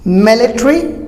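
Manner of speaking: military as MIL-i-tree; short ending on military, rising; British military with reduced "tary"; British pronunciation. Shown as a demonstration. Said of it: "British military".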